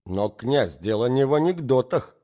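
annoyed. A narrator's reading. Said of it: "с досадою перебила".

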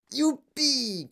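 high-pitched whoop; joyful squeal of an old grandfather; gleeful cry. A narrator's reading. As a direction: whoop with glee, high and stretched. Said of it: "cria grand-papa Joe".

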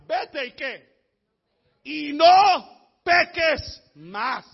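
very slow, punched "no peques más"; loud, drawn-out command on "no peques más"; emphatic command. Retold or imitated. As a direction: hit "no" and "peques" hard and loud, spaced apart. Preacher re-voices the command emphatically.

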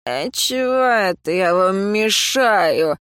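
put-on slow, slurred indignant drunk; town drunk. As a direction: whine it like a hiccuping drunk. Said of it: "возмутился мужчина, громко икнув".

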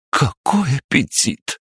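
very high, slow, adoring exclamation; cooing. A narrator's reading. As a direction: gush over the creature, drawing it out high. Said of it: "Ещё больше умилились поварихи". (с большим умилением)

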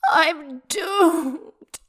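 high melodramatic wail. A narrator's reading. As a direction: wail it like a lost cause. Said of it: "wailed Yan Yu".

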